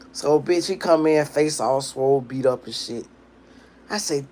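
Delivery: ordinary delivery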